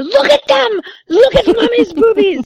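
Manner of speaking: very high-pitched imitation of an insistent little kid; mock toddler shout, high and insistent. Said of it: "the more she said louder".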